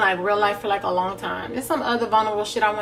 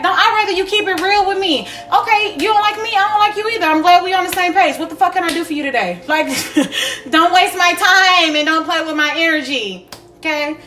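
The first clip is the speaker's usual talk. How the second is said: higher and louder, sassy and fed up; sassy confrontation; 6 semitones higher, 3 dB louder than usual. Pretend. To a two-faced person, a loud sassy telling off. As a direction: tell a fake friend off, higher.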